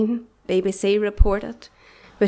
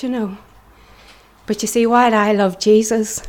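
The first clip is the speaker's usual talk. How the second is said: ordinary delivery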